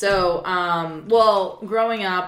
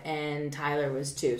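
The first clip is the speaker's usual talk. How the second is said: ordinary delivery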